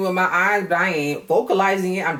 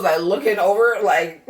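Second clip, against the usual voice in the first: normal talking voice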